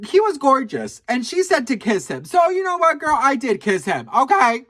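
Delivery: defiant confession. Real, not acted. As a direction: say it proudly, no regrets.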